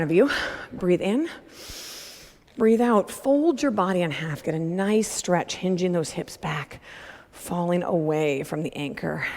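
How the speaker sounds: soft, calm instructor voice; calm stretch guidance. Acted. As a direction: ease them into the stretch. Guides cool-down stretches.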